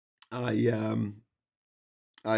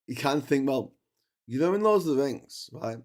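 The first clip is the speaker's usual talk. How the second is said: ordinary talk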